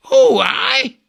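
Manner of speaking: sudden high cry of disbelief; shocked yelp. Acted. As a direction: jolt up high and loud, outraged. Thersites balks at being sent.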